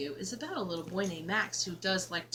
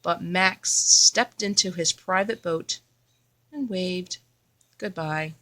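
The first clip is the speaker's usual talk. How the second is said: put-on expressive read-aloud for a child; story narration; 14 dB louder than usual. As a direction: read it like a bedtime picture book. Reading picture book to child.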